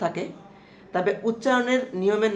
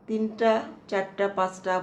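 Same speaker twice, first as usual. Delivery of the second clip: slow counting; slower than usual. Shown as a demonstration. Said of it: "counting the letters aloud".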